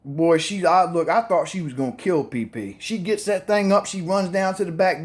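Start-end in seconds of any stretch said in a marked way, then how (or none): none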